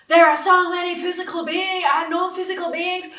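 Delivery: high, loud hammed-up American accent; exaggerated American lecturer's accent, badly faked; terrible American accent. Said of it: "it's my terrible American accent".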